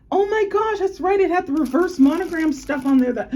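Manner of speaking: high, loud rush of excitement; thrilled realization. Real, not acted. Vlogger recalling her monogram bandeau, high thrilled gush. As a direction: gush as it all comes back.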